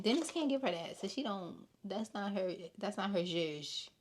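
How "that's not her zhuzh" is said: a light imitation of Portia; mock Portia, lightly put on. Podcaster mimicking Portia dismissing Shamea, lightly voiced. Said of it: "In my Portia voice".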